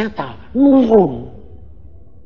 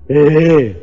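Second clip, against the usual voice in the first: teasing chuckle; 2 semitones lower, 5 dB louder than usual. Retold or imitated. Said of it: "ketawa deh".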